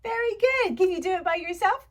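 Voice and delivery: high, loud teacher praise; delighted encouragement. Pretend. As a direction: urge a little pupil on brightly. Encouraging a young pupil.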